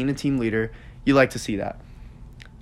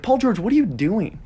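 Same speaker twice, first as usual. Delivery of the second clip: incredulous; 7 semitones higher than usual. Retold or imitated. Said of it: "I'd love to see it from the announcer".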